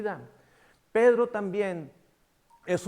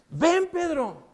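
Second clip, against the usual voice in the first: slow, much higher gentle call; inviting call; 9 semitones higher, 4 dB louder, much slower than usual. Retold or imitated. Jesus inviting Peter to walk toward him, slow and high. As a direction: beckon him onto the water.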